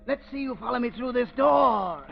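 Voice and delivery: ordinary talk, nothing marked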